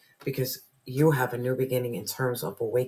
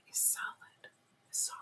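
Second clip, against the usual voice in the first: whisper; 14 dB quieter than usual. Real, not acted. Reader stresses spiritual point.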